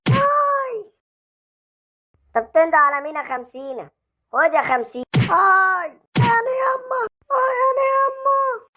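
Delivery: high, slow cries of pain; pained howls. Acted. Thug howling for his mother while beaten. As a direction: wail for your mother.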